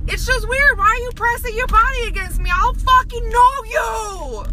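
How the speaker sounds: high, exasperated outburst; indignant protest. Acted. Ranting at strangers who press in for hugs, indignant. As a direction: protest in disbelief, stretching the last words.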